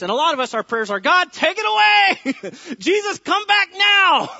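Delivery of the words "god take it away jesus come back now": high, slow, loud pleading cry with a laugh; hammed-up begging to be rescued now; mock desperate prayer.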